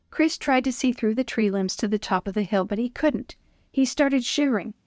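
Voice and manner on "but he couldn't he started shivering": ordinary delivery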